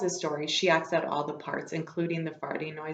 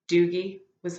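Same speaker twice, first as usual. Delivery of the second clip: ordinary delivery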